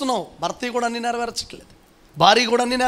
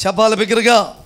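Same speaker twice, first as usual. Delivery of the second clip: urging loudly; 2 semitones higher, 7 dB louder than usual. Real, not acted. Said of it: "బిగ్గరగా చెబుదాం".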